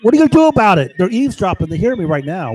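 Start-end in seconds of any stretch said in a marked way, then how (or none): 0.0-0.9 real furious yell at the listeners; yelling a challenge at hidden spies; angry shout. Real, not acted. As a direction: shout it high and loud in defiance. Taunting eavesdroppers who hear him.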